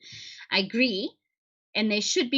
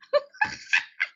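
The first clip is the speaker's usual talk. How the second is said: high laugh. Real, not acted.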